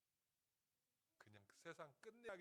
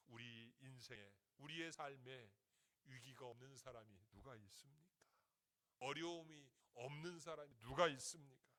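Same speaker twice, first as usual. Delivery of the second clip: usual speaking voice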